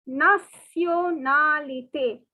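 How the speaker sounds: nationalité drawn out syllable by syllable; slow classroom nationalité; slow French nationalité. Shown as a demonstration. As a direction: stretch out nationalité for learners. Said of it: "the word nationality is pronounced as".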